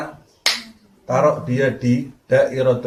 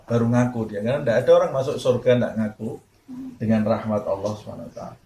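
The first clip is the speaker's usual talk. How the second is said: ordinary talk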